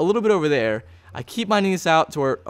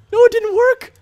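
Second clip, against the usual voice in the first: high, loud cry of disbelief; dismayed shout; 7 dB louder than usual. Real, not acted. Gamer to viewers as portal fails, high dismayed shout. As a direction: yell it out as the plan collapses.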